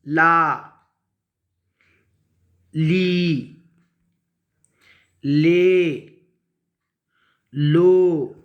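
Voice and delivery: long, isolated syllables with wide pauses; teacher's read-along recitation; direct syllable reading. Shown as a demonstration. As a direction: read each joined syllable out firmly, drawn out. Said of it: "direct पढ़ सकते हैं".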